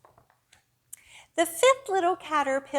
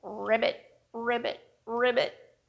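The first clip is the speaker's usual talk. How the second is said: lightly voiced frog ribbits; playful frog ribbit; frog croak. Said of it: "Hear its funny sound".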